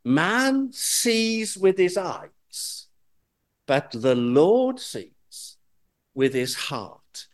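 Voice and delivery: "sees" stressed, slow and measured; emphasis on seeing. Shown as a demonstration. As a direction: dwell on "sees" in both halves, slowly. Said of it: "keeping the emphasis on seeing".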